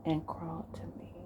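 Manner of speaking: hushed, intimate delivery with long pauses; seductive role-play. Acted. Man giving his partner intimate orders, hushed seduction.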